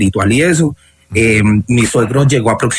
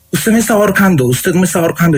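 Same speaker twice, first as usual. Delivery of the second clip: frightened wife crying out in retelling; retold scream; 6 semitones higher, faster than usual. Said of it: "se levantó gritando". (grito asustado imitado, agudo pero sin volumen)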